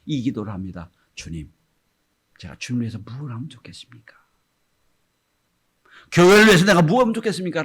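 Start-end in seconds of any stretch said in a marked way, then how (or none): 1.2-4.2 fast unvoiced prayer; whispered prayer. A grown disciple prays to the Lord, whispered. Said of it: "라고 기도가 바뀌어요". 6.1-7.6 fast, louder, higher plea; fervent prayer. Acted. Disciple prays for the church, fast fervent plea. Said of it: "라고 기도가 바뀌어요".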